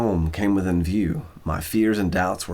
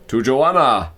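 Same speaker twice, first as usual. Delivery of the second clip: louder, raised toast; father's toast; 4 semitones higher, 7 dB louder, slower than usual. A narrator's reading. Cat father toasting his son's fiancée, hearty toast.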